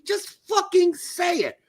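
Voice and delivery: high, forceful, stretched-out demands; exasperated outburst. Real, not acted. Host demanding the coach just admit it, exasperated outburst. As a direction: let frustration boil over, pitch way up.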